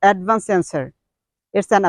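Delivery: ordinary talk, nothing marked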